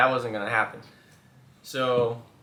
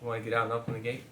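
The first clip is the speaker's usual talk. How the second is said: brisk, lightly mocking quote of him; quick mock ask; 2 semitones lower, 7 dB quieter, much faster than usual. Wife mocking husband's gate request, fast and light. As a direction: rattle off his request quickly.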